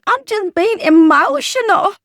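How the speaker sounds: high, loud mock whine of an excuse. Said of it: "That's lie number five".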